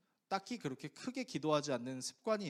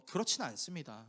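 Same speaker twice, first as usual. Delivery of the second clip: ordinary talk, nothing marked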